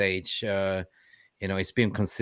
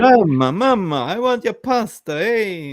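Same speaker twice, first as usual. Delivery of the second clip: high, loud mock Italian whine; hammed-up Italian mama's boy; Italian accent; 11 semitones higher, 8 dB louder than usual. Mocking an Italian manager's voice.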